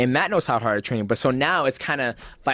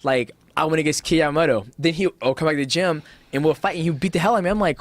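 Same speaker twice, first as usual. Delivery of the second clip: usual speaking voice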